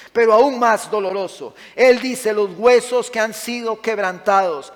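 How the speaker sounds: loud, high, impassioned sermon delivery; fiery preaching. Acted. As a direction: preach it out with full force to the congregation. Pastor preaching loudly to congregation.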